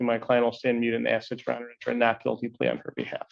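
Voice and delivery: normal talking voice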